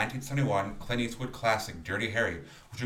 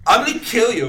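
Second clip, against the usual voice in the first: high, loud laughing retelling; laughing loudly; 11 semitones higher, 15 dB louder than usual. Retold or imitated. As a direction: crack up while telling it.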